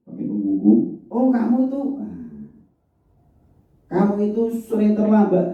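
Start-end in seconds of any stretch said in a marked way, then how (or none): 1.1-5.5 high-pitched, accusing telling-off; punisher's scolding. Shown as a demonstration. Teacher as punisher to an often late pupil, scolding. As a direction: scold a repeat offender, voice held high. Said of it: "Nah, penghukum dia".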